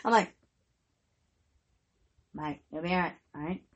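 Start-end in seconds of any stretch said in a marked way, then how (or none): 2.3-3.6 much softer, flat, fed-up reassurance; annoyed tone. Retold or imitated. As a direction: reassure him while clearly annoyed. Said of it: "Gotta change my tone and shit".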